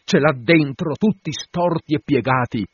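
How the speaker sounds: excited captain's reply; eager confirmation. Acted. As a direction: confirm it with loud, mounting conviction.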